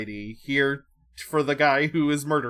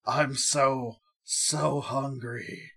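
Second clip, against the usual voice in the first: very slow, drawn-out hunger; slowly acted craving; mock ravenous monster; much slower than usual. Voicing the transforming man, starving.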